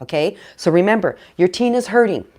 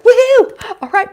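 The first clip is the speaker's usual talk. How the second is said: high loud whoop, much higher and louder; thrilled cheer; 9 dB louder than usual. Retold or imitated. Parent cheering when their teen opens up, loud high whoop. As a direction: cheer it out like you've won. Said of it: "and you're like".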